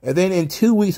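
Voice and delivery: ordinary delivery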